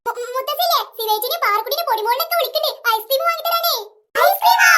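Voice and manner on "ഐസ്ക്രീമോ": high, loud gasp of delight at ice cream; child thrilled to hear about ice cream, high and loud; excited surprise. Acted. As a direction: squeal it like a kid promised a treat. Kids hear of free ice cream.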